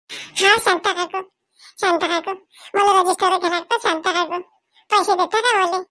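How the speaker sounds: put-on high, coaxing little girl asking for money; a child sweetly asking auntie for money for a register; young girl's voice. Child Pinky asks aunt for money. (लहान मुलगी काकूकडे पैसे मागते, उंच आवाजात)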